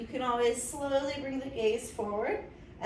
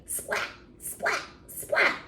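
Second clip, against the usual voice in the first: lightning splat; much slower than usual. Shown as a demonstration. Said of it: "very hard to make a noise like that".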